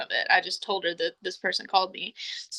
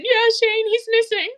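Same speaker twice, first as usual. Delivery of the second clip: very high mock-distraught wail; imitated crying; 14 semitones higher, 5 dB louder than usual. Distraught caller reporting a man missing, mock crying. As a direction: put on a high, tearful voice.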